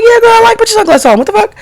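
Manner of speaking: very high, gushing voice; excited yes. Retold or imitated. Retelling her reply to Toot, very high starstruck gushing. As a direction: gush like a starstruck fan. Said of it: "So I'm like".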